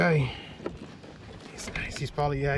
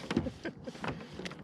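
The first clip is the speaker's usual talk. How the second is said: soft unvoiced giggle; breathy giddy laugh; 8 semitones higher, 13 dB quieter than usual. Real, not acted. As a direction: laugh under breath, thrilled. Laughing after catching fish.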